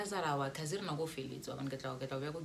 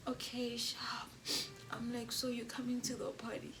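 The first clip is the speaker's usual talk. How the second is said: soft whisper; 8 semitones higher, 5 dB quieter than usual. Retold or imitated. Coaxing him gently.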